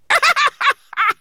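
very high, loud bird-like cackle; shrill cackle. Acted. Monster spreading its wings, shrill cackle. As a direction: shriek out a cackle. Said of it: "With a shrill cackle".

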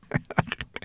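short breathy giggle. A narrator's reading. Sorrel laughs at the sight of Ben, soft giggle. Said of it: "giggling at the sight of him".

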